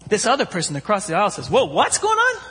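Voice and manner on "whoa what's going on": much higher, laughing astonished cry; amazed exclamation. Retold or imitated. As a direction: squeal it in amazement. Passenger across aisle amazed.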